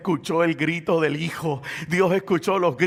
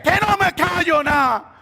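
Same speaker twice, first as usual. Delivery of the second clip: high, loud refusal; full-force acted defiance; defiant shouting; 10 semitones higher, 5 dB louder than usual. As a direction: shout back over the crowd. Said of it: "Y él gritaba más duro".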